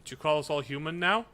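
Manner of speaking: ordinary talk, nothing marked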